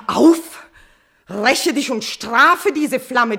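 frantic plea for revenge; desperate command. Acted. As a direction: throw the demand at him. Queen demanding punishment.

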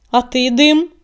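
loud, abrupt demand; brusque, pressing query; sharp question. A narrator's reading. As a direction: ask it sharply, raising the voice. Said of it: "резко спросил он".

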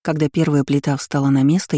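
ordinary delivery, nothing marked

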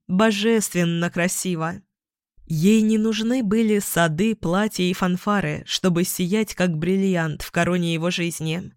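plain reading voice

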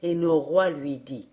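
plain reading voice

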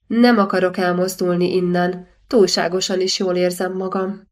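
ordinary delivery

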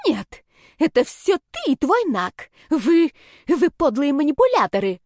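high, loud, outraged finger-pointing; spiteful accusation. A narrator's reading. Girl accuses the heroine and her friend, high and spiteful. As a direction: sputter the accusation in a high angry voice. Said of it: "с перекошенным от злости лицом".

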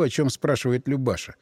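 ordinary delivery

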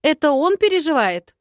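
high, loud echo question, falling on "он"; indignant disbelief. Acted. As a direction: throw it back in outrage, punching "он". Outraged echo of his claim.

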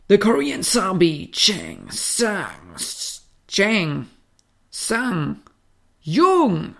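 drawn-out announcer callout; boxing ring announcer. Retold or imitated. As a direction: announce the challenger with flair. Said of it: "So in etwa hört sich das an". (Ringsprecher-Ansage)

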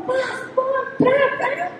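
high, quick mock splash sounds; playful sound effects for the plunge; splash noises. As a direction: make the noise of her hitting the water.

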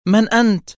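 terrified cry. A narrator's reading. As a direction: cry out in fright. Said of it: "عادت تهتف في رعب". (هتاف مرعوب بنبرة عالية)

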